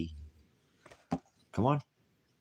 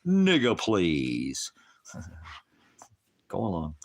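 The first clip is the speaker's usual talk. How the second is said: mock Jesus retort; much slower than usual. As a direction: toss it off in a mocking sneer. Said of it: "Or did he say".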